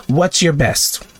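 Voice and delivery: usual speaking voice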